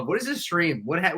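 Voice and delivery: ordinary delivery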